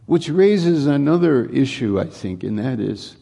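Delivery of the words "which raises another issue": loud, higher preaching climax; full-force preaching. Acted. Pastor urging believers to speak good things at work, preaching loud and high. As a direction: lift the congregation with a loud high-pitched preach. Preacher raises new point.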